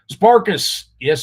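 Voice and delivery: ordinary delivery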